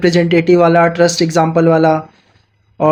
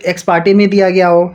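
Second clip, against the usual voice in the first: ordinary talk, nothing marked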